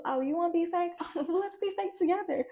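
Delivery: high, sugary fake-friendly voice; mock sweet fake voice. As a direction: play the phony friend, sweet on top. Mocking a two-faced person.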